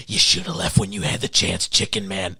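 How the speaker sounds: low, quiet menace from a psychopath; mild menacing threat. Retold or imitated. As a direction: threaten him softly. Billy threatens with a knife.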